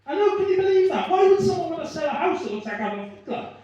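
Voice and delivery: high, loud mock disbelief; mock outrage. Real, not acted. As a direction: act scandalised and loud.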